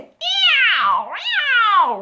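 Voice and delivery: long, high, loud yowl; screeching cat meow. A narrator's reading. Cat screeches at the squeaky bed, loud yowl. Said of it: "each of the animals joined in".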